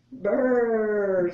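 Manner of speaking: loud high cold brr; shivering brr. Acted. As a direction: shiver through it. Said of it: "says the badger".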